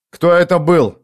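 sharp demand. A narrator's reading. Gang leader wheeling round on the survivor, sharp demand.

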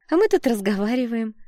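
falsely innocent chirp; smiling, sweet. A narrator's reading. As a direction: cover up sweetly with a smile. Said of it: "улыбнулась она".

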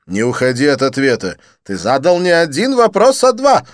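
high, loud, theatrical French friend; excited Didier. Acted. Didier greeting his friend Lin, high excited gush.

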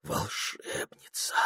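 low, slow, hushed sigh; sighed admiration. A narrator's reading. Прохор sighing to the beautiful witch, sighed admiration. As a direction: breathe it out softly, slowly. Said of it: "вздохнул он".

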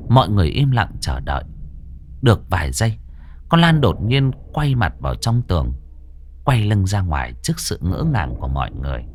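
steady storyteller reading a ghost tale; story narration. A narrator's reading. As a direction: tell it like a spooky radio story. Reads horror story narration. (giọng kể chuyện ma)

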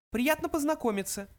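very high small creature voice; mouse voice. A narrator's reading. Second mouse meets Arthur, high little voice. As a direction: pipe it politely, way up high. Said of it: "сказал другой мышь".

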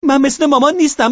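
loud high scream stressing مامان; girl screaming. Acted. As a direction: scream it, hitting مامان hardest. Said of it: "لیزا فریاد کشید". (فریاد بلند دخترک روی مامان)

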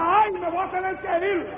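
high mock wail of defeat; retold demon's cry. Demon admitting he must leave, imitated high cry. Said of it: "Y decía".

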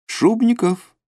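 high-pitched call of a name. A narrator's reading. Voice from behind the trees hails Shubnikov, calling out. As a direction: call to someone from among the trees. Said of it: "позвали его из-за деревьев".

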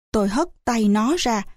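plain reading voice

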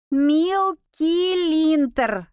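spelled out slowly, syllable by syllable. A narrator's reading. The bat correcting the girl's mistake, slowly by syllables. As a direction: correct her slowly and firmly. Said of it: "по слогам повторило оно".

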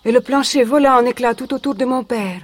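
normal talking voice

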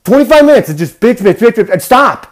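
high, loud mocking rant. Telling the audience about the complainers, loud rant.

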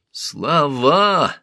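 sharp high snarled word; angry snarl. Acted. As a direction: spit it out in fury. Thutmose snarls in fury.

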